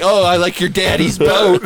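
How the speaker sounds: slow, sing-song taunt; slowed mock sweetness. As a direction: sneer at the spoiled kids slowly. Mocking the rich teens.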